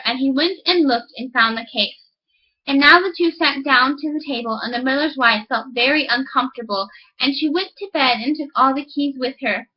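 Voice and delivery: ordinary delivery, nothing marked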